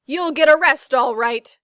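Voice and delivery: loud and high shout; triumphant shout. A narrator's reading. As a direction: bellow it as you burst in. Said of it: "shouted Mister Dart".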